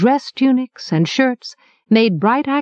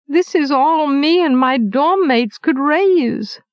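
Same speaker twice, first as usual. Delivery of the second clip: higher, slower pleading whine; piteous plea; 6 semitones higher, slower than usual. Acted. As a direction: beg the baker pitifully, voice rising. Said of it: "His voice went up in a piteous note".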